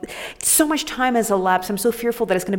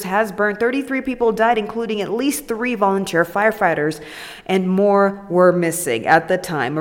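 ordinary talk

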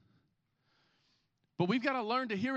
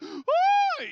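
very high, loud whooping wail; a sudden high little-kid yelp; imitated toddler whoop; 6 dB louder than usual. Preacher imitating his son's high wail at the playground. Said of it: "he's like".

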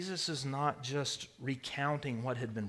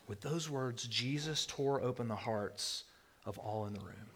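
ordinary delivery

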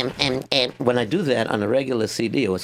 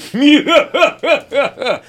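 loud high villain cackle; hammed-up villain laugh; sinister Russian laugh; 12 semitones higher, 9 dB louder than usual. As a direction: burst into a big evil laugh. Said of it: "You can have a Russian say".

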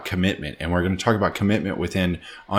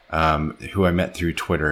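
ordinary talk, nothing marked

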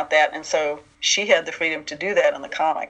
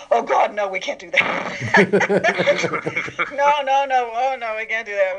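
higher-pitched flustered protest, breaking into laughter; mock alarmed refusal; 4 semitones higher, slower than usual. Toy executives refusing the writer's idea, mock alarmed. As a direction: imitate executives recoiling in mock panic.

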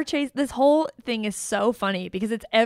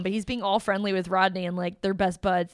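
ordinary talk, nothing marked

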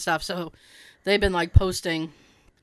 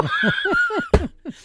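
a squealing burst of laughter; high laughing shriek. Real, not acted. Laughing at her husband's confession.

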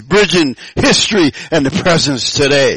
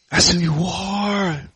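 slow, hushed reassurance; soft affirmation; 7 dB quieter, slower than usual. Real, not acted. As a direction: let it land softly and slowly. Repeats the affirmation softly.

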